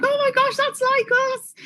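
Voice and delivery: put-on very high, little-kid squeal; excited little kids. Little kids hearing Brummie in the cinema, excited. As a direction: imitate amazed children in the cinema. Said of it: "the little kids in the cinema were like".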